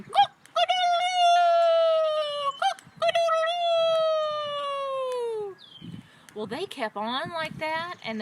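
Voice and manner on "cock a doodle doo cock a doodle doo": very high, loud, long crow; rooster crowing. A narrator's reading. Storyteller voices rooster singing proudly, full-blast crowing. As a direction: crow at full tilt, holding the last note. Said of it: "the rooster singing".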